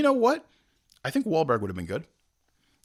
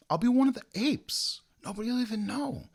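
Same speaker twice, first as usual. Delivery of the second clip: mock hopeful plea, high-pitched; eager pleading; 9 semitones higher than usual. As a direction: pitch it high, coaxing for a favor. Acts out Donnie begging for cameo.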